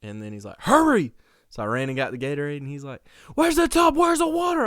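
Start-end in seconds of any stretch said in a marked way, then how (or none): 0.6-1.1 high, loud shout; imitated frantic yell; urgent dad yell. Dad yelling from bedroom. 3.3-4.7 high, loud demanding shouts; imitated panicked hollering; frantic dad yelling.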